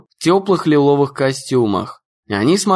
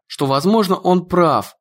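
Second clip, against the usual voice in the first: ordinary delivery, nothing marked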